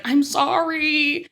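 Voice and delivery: high, stretched-out guilty sorry; sheepish apology. Retold or imitated. Nancy asked if she loves Dale, imitated sheepish apology. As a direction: imitate a helpless apology, slowly. Voices Nancy caught cheating.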